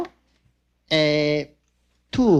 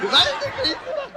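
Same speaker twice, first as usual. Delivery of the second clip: "wild and crazy man" shot up into a much higher squeal; squealed catchphrase. Acted. Comic hamming up the catchphrase for laughs, high squeal. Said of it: "Steve Martin, comedian".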